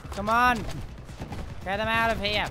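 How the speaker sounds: high, loud urging; real battle cry; fired-up shout. Real, not acted. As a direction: yell at the team to push. Urging team.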